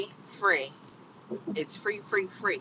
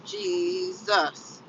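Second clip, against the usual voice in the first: ordinary talk, nothing marked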